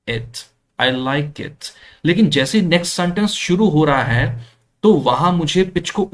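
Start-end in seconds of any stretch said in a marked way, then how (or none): 0.1-1.7 "I like it" said slowly, pitch sinking to the end; slow falling end. Shown as a demonstration. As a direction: draw it out and let it fall. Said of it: "pitch है वो down आती है".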